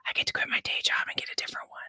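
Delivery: fast secretive whisper; whisper yelling. Real, not acted. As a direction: hush it so the universe won't hear. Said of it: "Why are we whispering?".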